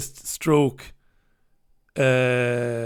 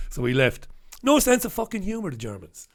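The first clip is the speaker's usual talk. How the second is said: usual speaking voice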